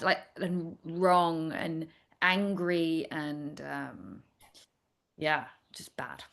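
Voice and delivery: sad. Real, not acted. Describing feeling out of alignment.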